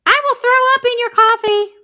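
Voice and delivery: squeaky mischievous cat; cat creamer voice. Pretend. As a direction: play the cheeky cat pitcher.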